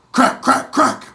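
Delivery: sharp, high, loud mock gunshot cracks. Gunshots ring out at the fleeing boy, loud mock cracks. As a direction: spit out three loud cracks like musket fire.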